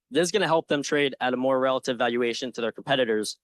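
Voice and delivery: ordinary talk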